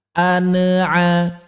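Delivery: "an'a" with a bounced n, like "ane-a"; nun dipantulkan. Shown as a demonstration. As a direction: add a short vowel after the n in "an'a". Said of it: "misalnya dipantulkan". ("an'a" dengan nun memantul seperti "ane-a")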